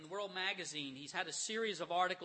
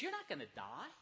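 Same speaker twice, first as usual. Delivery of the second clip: lightly voiced tempting devil, sly and rising; a hint of the sly serpent luring Eve; half-played devil coaxing, swinging higher; 4 semitones higher than usual. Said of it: "the devil comes in and he says".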